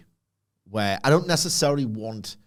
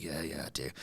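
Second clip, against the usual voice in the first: hushed admission; 8 semitones lower, 15 dB quieter than usual. Retold or imitated. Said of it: "I was like".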